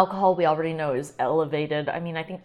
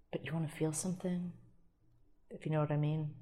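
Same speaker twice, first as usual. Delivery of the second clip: soft, suggestive and hushed; suggestive innuendo; 2 semitones lower, 11 dB quieter, faster than usual. Acted. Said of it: "I'm already, like, innuendoing".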